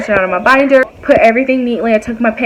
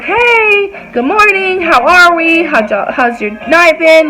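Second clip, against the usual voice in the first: high, bright hello to coworkers; cheery greeting; 6 semitones higher, slower than usual. Retold or imitated. Greeting coworkers on arriving at work, cheery. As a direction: sing out a friendly morning greeting.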